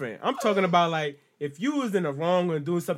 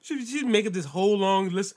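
ordinary talk

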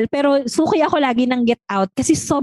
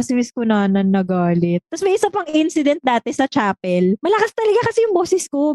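ordinary delivery

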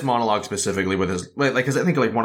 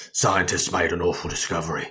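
overly dramatic monologue in a lowered voice; 4 semitones lower than usual. Acted. Co-host quoting the film's historical monologue, overly dramatic Bale impression. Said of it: "it's so fucking overly dramatic".

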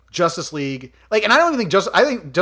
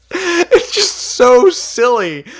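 giggling, higher and louder, slow; laughing; 10 semitones higher, 9 dB louder, much slower than usual. Real, not acted. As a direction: talk through a fit of laughter. Laughing after Unikitty quote.

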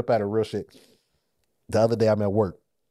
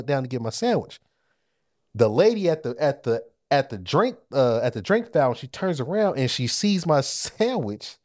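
ordinary delivery

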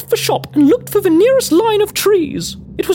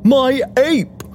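distressed gentleman; 5 semitones lower than usual. Acted. A colleague begs Missus Cavendish for help, distressed. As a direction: plead in a lower male character voice. Plays Phineas Walton explaining.

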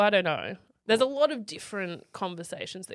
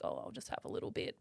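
coy whisper; 13 semitones lower, 12 dB quieter, faster than usual. Retold or imitated. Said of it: "you're like".

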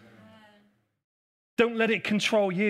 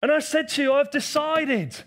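raised, fervent preaching voice; 5 semitones higher than usual. Acted. Preacher rallying the church to worship before the calm, fervent. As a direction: build it up like a revival sermon.

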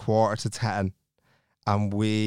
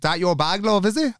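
higher-pitched mock challenge, rising at the end; confrontational; 7 semitones higher than usual. Charlotte to woman over the bag, mock confrontational. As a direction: imitate a woman picking a fight.

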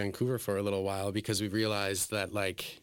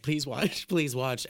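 higher, laughing plea; mock pleading; 5 semitones higher, 3 dB louder, slower than usual. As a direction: beg half-jokingly through a laugh. Begging audience to watch online.

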